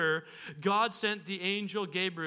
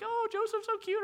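high, giggly gush; a smitten bride-to-be giggling; giggly girl; 12 semitones higher than usual. Pretend.

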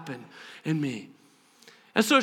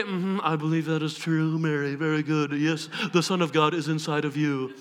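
low, measured mock approval; staid confirmation; 8 semitones lower, 4 dB quieter than usual. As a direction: confirm it politely with no celebration. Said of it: "not just here to say".

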